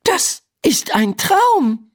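high, slow, emphatic realization; brightening realization. A narrator's reading. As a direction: declare it slowly as it becomes clear. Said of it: "ihre Miene hält sich auf". (erleichterte Erkenntnis, hoch und langsam)

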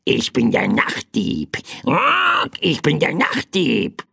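cawing little night-thief bird; croaking bird. A narrator's reading. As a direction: croak it out with squawks between phrases. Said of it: "krächzte das kleine Federvieh".

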